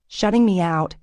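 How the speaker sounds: ordinary delivery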